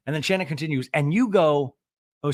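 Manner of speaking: ordinary delivery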